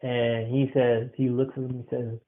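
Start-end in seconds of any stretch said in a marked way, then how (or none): none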